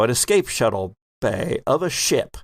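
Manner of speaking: ordinary delivery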